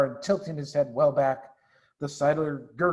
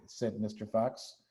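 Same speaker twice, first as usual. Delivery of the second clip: plain reading voice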